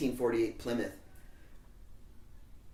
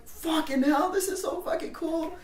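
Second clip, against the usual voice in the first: put-on much higher, awed teen gush; starstruck. Teen fan seeing band photos on the fridge. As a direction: gush like a starstruck punk kid. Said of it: "I'm just like".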